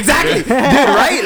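high, loud burst of real agreement; excited agreement. Real, not acted. Host heartily agrees with co-host's point, excited. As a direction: jump in, thrilled and loud.

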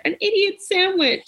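high, small voice; girl answering the chef in a high meek voice. Retold or imitated. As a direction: squeak it out, ashamed. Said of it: "she's like".

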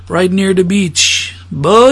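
drawn-out slow quote; slow movie quote. Retold or imitated. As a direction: stretch each word slowly. Quoting a Jamaican film line.

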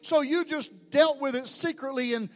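full-force preaching. Acted. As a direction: hammer each "take me" home.

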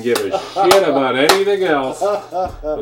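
slow, high, very loud complaint; loud exasperation. Real, not acted. Player who only wanted that supply token, loud exasperation. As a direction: drag it out loudly, exasperated. Complains about missing the six.

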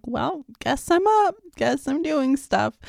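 lightly voiced mock resignation at dawn; resigned mock cheer. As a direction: shrug it off, high and slow. Resigned quote of herself waking.

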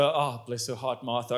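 ordinary talk, nothing marked

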